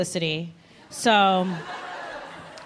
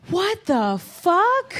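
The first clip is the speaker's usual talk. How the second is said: shocked; 6 semitones higher, much slower than usual. Retold or imitated. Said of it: "I was like".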